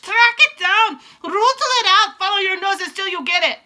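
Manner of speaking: very high, very loud scream. Acted. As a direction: scream the order. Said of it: "screamed the Grand High Witch".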